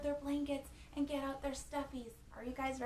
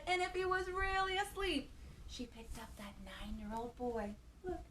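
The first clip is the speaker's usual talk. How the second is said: ordinary talk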